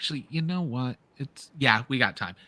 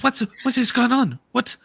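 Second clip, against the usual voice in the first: higher, louder, lightly voiced alarmed newcomer; alarmed gnome; 9 semitones higher, 5 dB louder than usual. Gnome head of Duskwatch rushing in, alarmed. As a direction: burst in demanding answers. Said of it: "She says".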